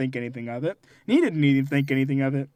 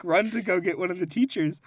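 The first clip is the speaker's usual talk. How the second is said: usual speaking voice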